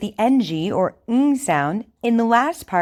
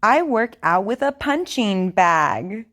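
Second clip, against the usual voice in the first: over enunciating. Shown as a demonstration. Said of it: "Repeat after me".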